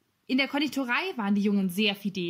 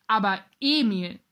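louder, drawn-out friendly protest; mock-shocked call; 5 dB louder, slower than usual. A narrator's reading. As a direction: call out in slow, good-natured reproach. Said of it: "der Kommissar rief". (gedehnt und mit Nachdruck gerufen)

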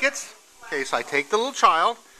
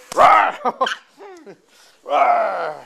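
loud mock monster roar; playful rawr; 6 semitones higher, 6 dB louder than usual. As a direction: roar at her like a playful beast. Dad playing monster with toddler.

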